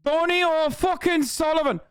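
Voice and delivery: put-on high, emphatic Irish accent. Streamer proving his Irish accent, high and emphatic Irish. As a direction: sing out the name in a showy Irish accent. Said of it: "my Irish accent is actually".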